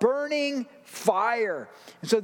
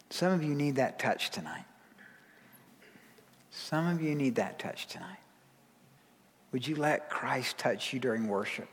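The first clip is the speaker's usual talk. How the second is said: tender hush; 6 semitones lower, 4 dB quieter than usual. Real, not acted. Pastor comforting the congregation before worship, hushed and tender. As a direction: lean in close and comfort them gently. Tender altar moment in sermon.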